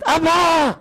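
a daughter calling her father in panic; high shrill call for help; daughter shouting. Retold or imitated. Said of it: "צועקת".

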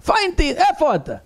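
high shouted call across the house; the rabbi shouts for the barber from the sukkah; calling out loud. Retold or imitated. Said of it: "צועק".

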